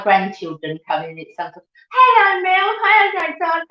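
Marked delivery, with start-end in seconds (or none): 1.9-3.6 high, loud hello; delighted greeting. Real, not acted. Mum welcoming her friend Mel into the stream, delighted.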